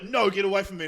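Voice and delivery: imitated indignant outburst; retold angry rebuff. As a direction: act out an old man angrily shooing someone away. Retold angry man storming off.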